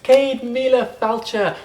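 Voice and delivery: bright, high welcome to listeners; lilting irish storyteller. Real, not acted. Storyteller greeting the audience.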